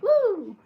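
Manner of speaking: high, loud "woo"; pumped-up whoop. Acted. Woman showing how music pumps her up, high loud whoop. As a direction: let out an excited whoop. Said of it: "I am like".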